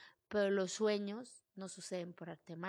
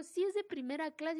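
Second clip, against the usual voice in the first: higher and louder, fed up; exasperated insistence; 8 semitones higher, 5 dB louder than usual. Retold or imitated. To the man tapping her shoulder, fed up and louder. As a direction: insist with rising annoyance. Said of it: "Y le digo".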